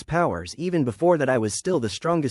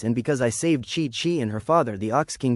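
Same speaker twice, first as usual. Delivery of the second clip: ordinary delivery, nothing marked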